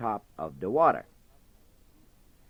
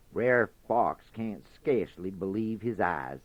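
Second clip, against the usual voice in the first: usual speaking voice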